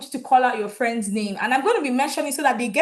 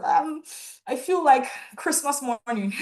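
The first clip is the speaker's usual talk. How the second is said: ordinary delivery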